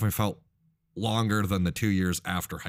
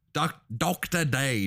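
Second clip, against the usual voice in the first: slow, higher mock-Aussie "Doctor Dave"; bad Australian accent; 6 semitones higher, slower than usual. Correcting co-host's plain version, bad Australian accent. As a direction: stretch the nickname in hammy Australian.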